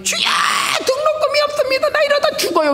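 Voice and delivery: very high, strained wailing plea; frantic student's prayer. Retold or imitated. Crazy-sounding student heard in the sanctuary, wailing very high. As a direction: wail like a madman begging God. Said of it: "웬 정신 나간 놈이".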